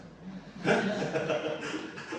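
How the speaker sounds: a real burst of disbelieving laughter; incredulous laugh. Real, not acted. Preacher laughing at a rude pastor's answer, incredulous laugh.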